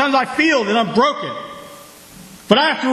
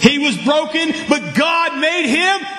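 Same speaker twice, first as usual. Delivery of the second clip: preaching at full force; 6 semitones higher than usual. Acted. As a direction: raise the church up with each line. Preaching climax.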